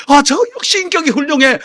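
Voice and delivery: high, loud gushing approval; imagined admiring praise. Retold or imitated. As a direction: marvel at someone's character. 교인들의 칭찬을 상상해 흉내. (과장되게 칭찬하듯)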